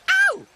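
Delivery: piercing high bird yelp; very high cartoon shout. A narrator's reading. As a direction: yell out loud like a squawking magpie. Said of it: "shouted Sticky Beak".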